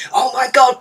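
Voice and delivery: ordinary talk, nothing marked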